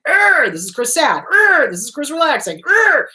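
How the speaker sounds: mock-angry growl bursts between labels. Speaker imitating herself angry whatever her mood, mock growling. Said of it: "I was just angry".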